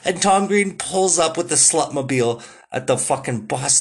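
normal talking voice

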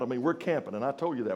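normal talking voice

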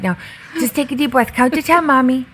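put-on high, childlike voice of a small boy calmly soothing mom; little son. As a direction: play a little kid gently coaching his mommy to calm down. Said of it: "and he's like".

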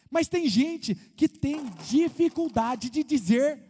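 ordinary talk

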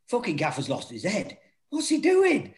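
imitating baffled players, jumping high at the end; mock disbelief. Players doubting their gaffer's new tactics, mock disbelief. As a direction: voice the lads' bewilderment, pitch shooting up on the question.

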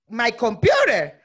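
loud, slow, stretched reply; mock disbelief. Traveler finally understanding the demand for her computer, loudly incredulous. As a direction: drag it out loudly in acted bafflement.

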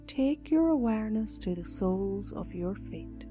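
soft, slow meditation voice; guided meditation. Acted. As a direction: guide them gently into calm.

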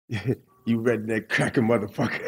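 lightly put-on American accent insult; hint of an American accent as he cusses the guard. Soaped-up prisoner cussing the guard in a light American accent. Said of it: "I think my accent must have been so good".

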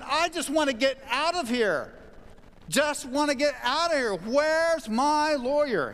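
high-pitched agitated demand, last words drawn out. Retold or imitated. Prisoner demanding his lawyer through the glass, very agitated. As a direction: act out an agitated prisoner wailing to get out. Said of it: "He was very agitated".

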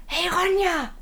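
hoch gerufenes "Hey Ronja", nicht wirklich laut; nachgeahmter Ruf. Retold or imitated. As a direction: die Mutter quer durch den Laden rufen, angedeutet. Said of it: "Sie schreit". (Mutter ruft die Tochter durch den Laden, leicht gespielt)

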